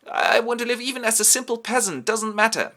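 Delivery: very high, loud mock wail; hysterical begging. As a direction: beg for life in hammed-up panic. Homburg begs hysterically.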